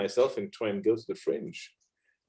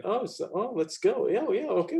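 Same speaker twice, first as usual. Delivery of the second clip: high, light mock-casual quote; breezy eager agreement; 11 semitones higher than usual. Voicing easy, carefree student decision.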